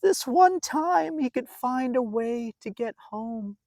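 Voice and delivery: put-on lively read-aloud voice for a children's story; storybook narration. A narrator's reading. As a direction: tell it like a bedtime picture book.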